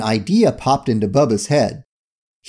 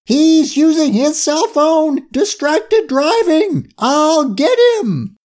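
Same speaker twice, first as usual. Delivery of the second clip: very high, slow squealing scream; screamed outrage; 3 dB louder, slower than usual. A narrator's reading. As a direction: scream each phrase out in drawn-out indignation. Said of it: "saw what was happening in the truck and screamed".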